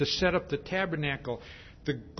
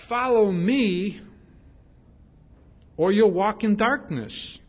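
commanding call; 4 semitones higher, 5 dB louder than usual. Acted. Preacher voicing Jesus' command.

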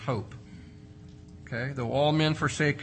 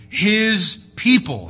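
slow emphatic answer; 6 semitones higher, 8 dB louder, much slower than usual. Acted.

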